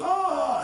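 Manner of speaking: high, loud scream; mock terrified scream. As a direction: scream like you're fleeing a chainsaw killer. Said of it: "He goes".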